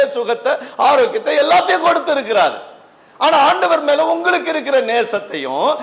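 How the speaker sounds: high, driving preacher voice; fervent preaching. Acted. Preacher urging congregation to compare their love with hers, fervent. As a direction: build the list high and emphatic.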